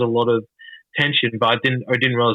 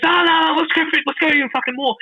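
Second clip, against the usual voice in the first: much higher, keen and pushing; eager mock insistence. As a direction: urge him on, high and eager. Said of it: "He goes".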